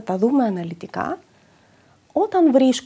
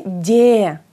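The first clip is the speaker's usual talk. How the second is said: Ντε stressed, loud and clear; stressed syllable; 2 semitones lower, 4 dB louder than usual. Shown as a demonstration. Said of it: "τονίζεται και το ακούμε". (Ντε τονισμένο και καθαρό)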